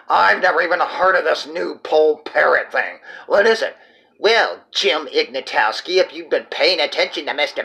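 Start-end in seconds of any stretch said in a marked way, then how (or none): none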